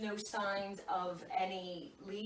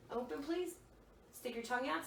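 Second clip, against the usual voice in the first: ordinary talk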